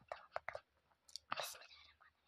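hushed aside to the camera; secretive whisper. Real, not acted. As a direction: whisper it so nobody hears. Prankster sneaks in, talks to camera. (gizlice fısıldayarak)

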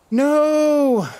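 high loud protesting cry; anguished shout. Real, not acted. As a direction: yell it in dismay. Forced to drop items.

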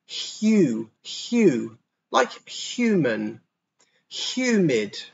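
"Hue" with a clear Y glide, then "humid"; slow down on "like human"; Y sound drill. Shown as a demonstration. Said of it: "we put a bit of a Y in it".

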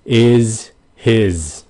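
"Is his" with both endings strongly voiced as z; emphasized Z. Shown as a demonstration. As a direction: press the buzz at the end of "Is" and "his". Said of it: "mình cố tình mình nhấn mạnh cái âm Z".

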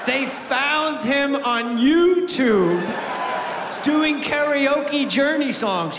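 slow, high, shouted build-up; big stand-up rant, pitched high; excited. Real, not acted. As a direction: lean in and shout it out slowly. Excited rant about Journey singer.

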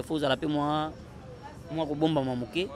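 ordinary talk

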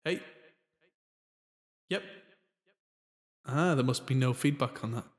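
ordinary delivery

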